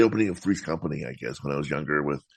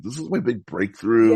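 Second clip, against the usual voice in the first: imitating an excited actress, a bit higher and louder; lightly voiced thrill, lifted and louder; retold excitement; 4 semitones higher, 5 dB louder than usual. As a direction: quote her mild excitement about a big break. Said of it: "she was initially really excited about".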